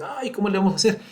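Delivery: hammed-up little plea of helpless pupils; mock worried student. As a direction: voice the students' mild fake worry. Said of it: "Dirán ustedes".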